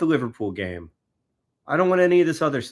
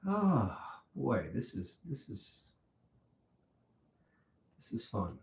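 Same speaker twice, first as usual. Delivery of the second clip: dry sarcastic mumble; 3 semitones lower, 13 dB quieter, slower than usual. Real, not acted. Sarcastic groan, very quiet.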